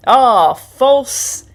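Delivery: imitating a sarcastic stranger, slow and loud; sarcastic sneer. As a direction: drag it out with mock charm. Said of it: "And then he went".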